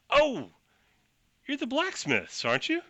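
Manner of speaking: lightly voiced smug official; feigned surprise at the smiths; high, sly recognition. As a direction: voice jumps up, falsely friendly. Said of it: "He says".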